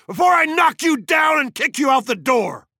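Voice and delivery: loud, high furious threat; yelling. A narrator's reading. Montag drives Missus Bowles out, yelling. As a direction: shout the threat at full force. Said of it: "he yelled".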